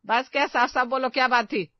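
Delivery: loud, sharp demand to speak clearly; stern demand. Acted. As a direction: press them hard. Mother-in-law demanding an answer. (ज़ोर से, सख़्ती से पूछना)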